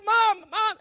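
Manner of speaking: high, loud cries for mom; panicked calling. Retold or imitated. Boy searching empty house for his mom, acted panic. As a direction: call out in acted rising panic. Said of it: "my voice started to shake".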